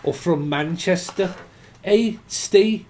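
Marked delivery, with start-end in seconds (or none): 1.8-2.8 put-on Dudley accent. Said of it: "someone from fucking Dudley".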